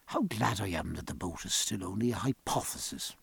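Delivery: low, very soft aside; hushed, relieved reflection; musing to himself. A narrator's reading. Stephen muses alone on shore.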